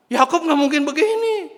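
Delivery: very high, loud denial; high-pitched outraged rejection; disbelieving shout. Acted. Acting brother refusing to believe.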